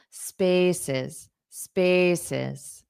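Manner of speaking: "spaces" stressed on the first syllable, ending dropped; teacher modelling "spaces" with a falling second syllable. Shown as a demonstration. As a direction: repeat "spaces" carefully, strong then weak. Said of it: "syllable stress on the first syllable".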